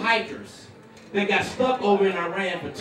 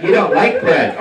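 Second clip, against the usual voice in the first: loud, lower mock-wife order; instructing wife; 4 semitones lower, 8 dB louder, slower than usual. Wife sending her husband to bed, loud instructing voice. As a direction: announce it firmly as the wife.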